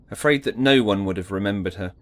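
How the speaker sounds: ordinary talk, nothing marked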